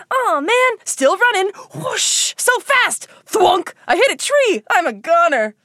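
high, excited running voice; breathless chase. Pretend. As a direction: play it frantic while fleeing. Acted chase.

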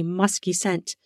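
plain reading voice

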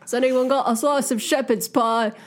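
hammed-up British food request; mock British accent. Podcaster capping the British slang riff, mock British accent. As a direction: play a cartoon Brit. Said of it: "other British slang".

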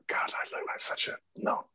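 whispered inner thought; embarrassed aside. Retold or imitated. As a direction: whisper it to yourself, mock mortified. Retelling an embarrassed thought to self.

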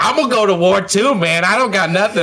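high, excited mock bravado; hyped-up war cry. Acting out a hyped fan joining the war, high and excited. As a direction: pump yourself up, pitch way up.

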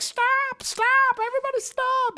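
very high squealed scream without the volume; high-pitched pretend shriek; mock screaming. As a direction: imitate screaming ladies in a high, held squeal. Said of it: "these ladies are just screaming".

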